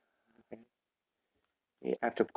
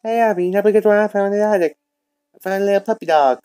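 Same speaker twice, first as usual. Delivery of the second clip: high, loud and fast with excitement; excited news; 8 semitones higher, 5 dB louder, faster than usual. Acted. Girl tells Abby about the attic puppy, excited and fast. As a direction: blurt it out, thrilled about the find.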